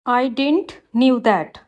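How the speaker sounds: slow, careful read of the wrong sentence; slow teacher dictation. Shown as a demonstration. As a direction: spell it out word by word for the class. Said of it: "wrong है".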